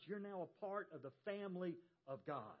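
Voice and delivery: ordinary talk, nothing marked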